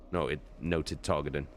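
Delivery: ordinary delivery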